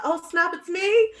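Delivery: high startled realization; surprised. Retold or imitated. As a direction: jump up in pitch, caught off guard. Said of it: "I'm like".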